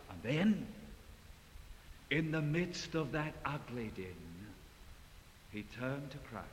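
normal talking voice

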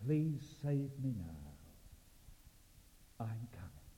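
soft, paused pleading; quiet penitent prayer. Acted. Preacher leads the listener's prayer to the Lord, quiet and penitent. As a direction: pray it gently, phrase by phrase.

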